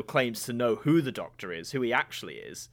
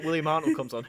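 usual speaking voice

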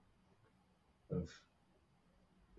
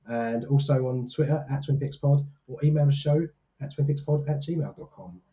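ordinary talk

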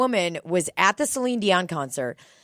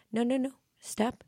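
soft, higher mock singer accent; [name]'s accent; 5 semitones higher, 5 dB quieter than usual. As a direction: softly protest in an imitated singer's accent. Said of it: "that accent where she's like".

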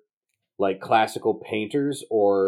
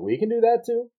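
high, delighted disbelief; surprised; 13 semitones higher than usual. Retold or imitated. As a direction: squeak it out in happy surprise. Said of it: "and I'm like".

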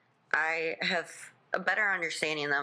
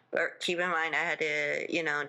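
ordinary talk

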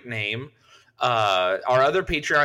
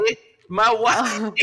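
high, strained British accent; British accent attempt; 7 semitones higher, slower than usual. Shown as a demonstration. Friend attempting a British accent on request. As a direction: try a British voice and miss. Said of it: "I can do a British accent".

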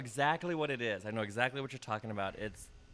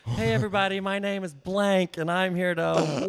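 loud mock host calling out to the crowd from the stage; stage announcer; 7 semitones higher, 6 dB louder than usual. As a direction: boom it out to open the show. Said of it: "announced my real name at a show".

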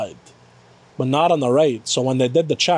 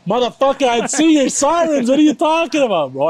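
raised, exasperated yell at the paramedics; frustrated yelling; 8 semitones higher than usual. Retold or imitated. Angry rant at ambulance crew.

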